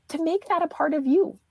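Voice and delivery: ordinary talk, nothing marked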